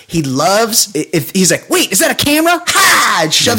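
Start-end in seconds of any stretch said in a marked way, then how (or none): 1.7-3.2 high, eager camera hog; mock excited celebrity. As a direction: squeal like a camera-hungry star. Mocking [name] spotting a camera.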